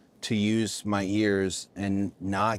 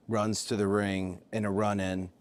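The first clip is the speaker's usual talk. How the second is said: usual speaking voice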